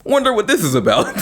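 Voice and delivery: high, wide-eyed mock wonder; a naive viewer musing about the title, in a high mock-curious voice. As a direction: play dumb and curious, way up high. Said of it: "be like".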